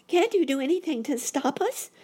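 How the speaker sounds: put-on high, loud child's plea; frightened boy rabbit. A narrator's reading. Boy rabbit begging uncle to stop the fall, high alarmed plea. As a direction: plead in a high, alarmed little voice.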